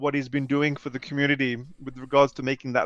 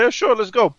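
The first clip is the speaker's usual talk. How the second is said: higher, louder, light retold enthusiasm; eager agreement; 7 semitones higher, 7 dB louder than usual. Agreeing to meet a fellow player, mildly eager. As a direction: mimic a keen, unthinking yes.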